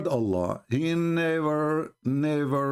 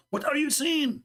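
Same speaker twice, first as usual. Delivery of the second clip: put-on high, indignant girl's voice; upset girl; 14 semitones higher than usual. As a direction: play the offended classmate, pitched up.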